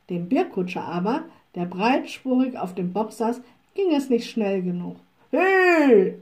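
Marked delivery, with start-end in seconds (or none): 5.3-6.2 loud, high, drawn-out "Hey"; coachman's shout. A narrator's reading. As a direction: yell roughly at the horses. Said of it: "schrie er". (lauter Kutscherschrei)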